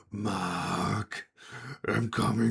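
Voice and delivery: drawn-out monster call; menacing creature. Acted. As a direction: call after your prey as a crawling beast. Creature calls after fleeing Mark.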